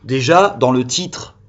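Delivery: normal talking voice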